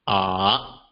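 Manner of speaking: "ah" dipping low then rising; low dip on "ah"; "ah" as the dipping third tone. Shown as a demonstration. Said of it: "the four tones of Mandarin Chinese".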